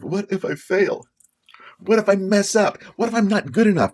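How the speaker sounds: high, worried what-ifs rising in panic; anxious inner voice. Acted. Vlogger voicing his own fearful doubts, anxious and high.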